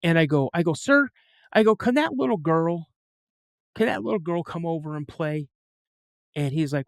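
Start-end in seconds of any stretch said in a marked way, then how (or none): 0.7-5.5 lightly acted high-pitched kid question; eager boy asking. Boy asks the neighbor about a playmate, high and eager. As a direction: pitch up like a hopeful child. Retelling his boyhood request to neighbor.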